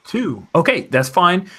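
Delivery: ordinary talk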